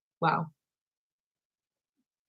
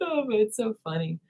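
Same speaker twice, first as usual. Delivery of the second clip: usual speaking voice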